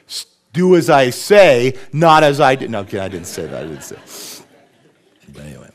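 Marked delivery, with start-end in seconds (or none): 0.1-2.7 much louder, slowed, mock-strict; stern parent. Father scolding his daughter Mariah, loud mock-stern command. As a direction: lay down the law like an exaggerated strict dad. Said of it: "I had to say to her".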